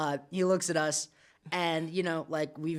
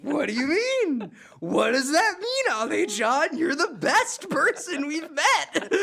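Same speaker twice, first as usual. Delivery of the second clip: high, loud, stretched-out disbelief; amazed excitement; 10 semitones higher, 6 dB louder than usual. Retold or imitated. Tourists amazed by the bartender, loud excited disbelief. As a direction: gush at him, laughing.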